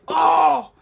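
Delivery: sudden loud high startled cry, breaking off mid-sentence; startled yelp. Real, not acted. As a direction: jump and shout at a sudden surprise. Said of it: "that was, like, the weirdest me move there".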